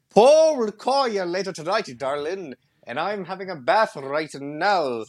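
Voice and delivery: high, slow mock Scottish, going English at the end; playful put-on Scottish voice; Scottish accent. Shown as a demonstration. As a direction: stretch the words out in a hammy Scottish lilt. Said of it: "stuck mostly with Scottish and maybe".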